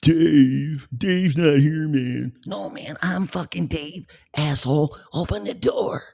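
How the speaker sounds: high stoner voice yelling through the door; [name]. Retold or imitated. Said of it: "Which one is it? Do I have it flipped around?".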